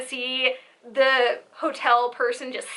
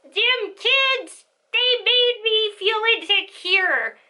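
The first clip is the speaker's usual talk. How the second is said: higher, mock cranky old woman; grumpy old lady; 8 semitones higher, slower than usual. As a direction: whine it like a grumpy granny. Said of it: "I can see her going".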